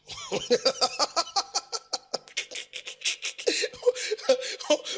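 long, high laughing fit; hearty laughter. Real, not acted. Preacher tickled by his pastor's saying, laughing hard.

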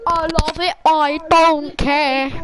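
loud and drawn out, slow; defiant. Real, not acted. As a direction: stretch it out defiantly. Defiant after arguing weapon.

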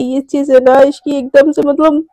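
ordinary delivery

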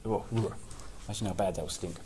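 usual speaking voice

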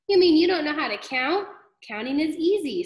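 put-on high, loud taunting kid; mocking classmate. Classmate mocks Joe.

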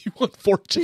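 laughing. Real, not acted. Echoing accent, laughing.

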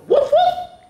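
high, loud playful woof; puppy bark. Acted. Teacher showing kids the puppy bark, high and loud. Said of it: "they go".

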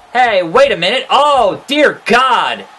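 high, loud commentator outcry; over-the-top shocked call; mock alarm. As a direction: shout it in hammed-up horror. Mock alarm at a game attack.

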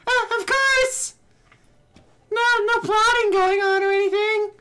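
very high, squeaky put-on voice; bad girl-character voice. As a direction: hold a silly high girl voice, brushing off worry. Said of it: "go back to the shitty voice".

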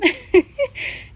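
a sudden high, loud burst of laughter; embarrassed giggle at the dirty glove. Real, not acted.

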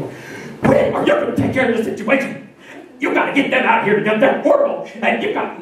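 harsh, hammed-up church lady complaint; rough, rasped mock outrage, little clear pitch; complaining church lady. Same lady complaining to the pastor next week, harsh mock outrage. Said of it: "I see the same lady".

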